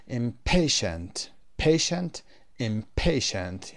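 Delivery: "impatient" and "patient", both with PA stressed. Shown as a demonstration. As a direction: punch PA slowly in "impatient" and "patient".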